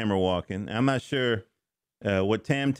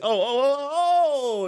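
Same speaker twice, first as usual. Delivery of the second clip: high, loud rising oh of discovery; mock amazed reader; 14 semitones higher, 4 dB louder than usual. Reader catching a hidden joke, loud high amazed oh. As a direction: cry out like you just caught the joke. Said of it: "you're gonna say".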